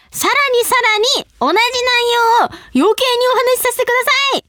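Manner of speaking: high, punched-out phrases building to a cheer; excited announcement. Real, not acted. Host revealing their own cup noodle collaboration, excited announcement.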